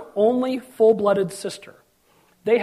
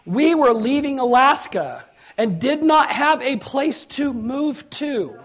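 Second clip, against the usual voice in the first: slow, higher-pitched story opening; deliberate, measured preaching delivery; slow and emphatic; 4 semitones higher, slower than usual. Real, not acted. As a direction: draw out each word for weight.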